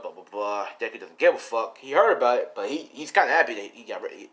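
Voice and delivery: plain reading voice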